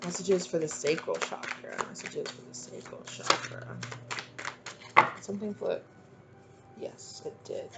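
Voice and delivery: near-whispered, breath-light words; hushed murmur. Real, not acted. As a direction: murmur it softly to yourself. Murmuring to herself while drawing cards.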